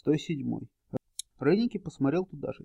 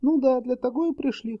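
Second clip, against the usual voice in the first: smirking voice from crowd; 10 semitones higher, 4 dB louder than usual. A narrator's reading. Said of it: "хмыкнул из притихшей толпы".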